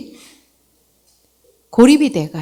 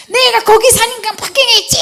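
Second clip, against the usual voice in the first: high, defiant mock snap back; indignant retort; 4 dB louder than usual. Preacher admitting what she really said, high indignant. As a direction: throw it back, pitched way up. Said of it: "이게 아니에요".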